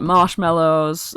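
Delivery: ordinary talk, nothing marked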